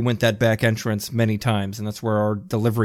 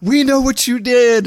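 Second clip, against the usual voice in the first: high, loud mock holler; imitated yell; 12 semitones higher, 5 dB louder than usual. Listener imitating mom at the thief, mock yell. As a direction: play the righteous yeller out the window. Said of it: "she yells out the window".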